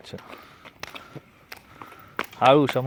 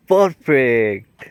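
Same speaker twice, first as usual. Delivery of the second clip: drawn-out, slow, loud praise; excited praise; 7 dB louder, much slower than usual. Real, not acted. Parent cheers the child's perfect catch, loud and slow. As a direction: stretch the word out to cheer the catch.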